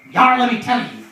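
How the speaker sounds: pirate. Acted. Pirate starting the eye patch story, loud pirate voice. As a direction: open a yarn as a pirate. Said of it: "He's like".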